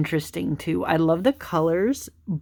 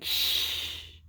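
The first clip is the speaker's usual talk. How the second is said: shushing; 9 dB quieter than usual. Retold or imitated. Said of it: "they're almost going like".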